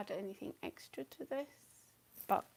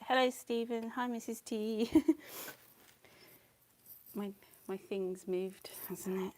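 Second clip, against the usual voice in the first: ordinary delivery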